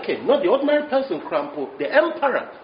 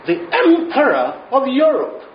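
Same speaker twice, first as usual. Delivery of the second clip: loud, raised-pitch herald's announcement; proclaiming titles; 6 semitones higher, 6 dB louder than usual. Retold or imitated. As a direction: announce a grand title at the door. Acts the knocker announcing grand titles.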